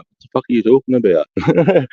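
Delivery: high, incredulous outburst; baffled disbelief. Retold or imitated. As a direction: sound completely lost and amazed. Retold baffled reaction to trackers.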